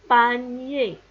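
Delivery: "panier" said loud and distinctly; teacher modelling "panier", ending in nié; mot modèle. Shown as a demonstration. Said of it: "Comme dans le mot". (« panier » articulé fort, comme en dictée)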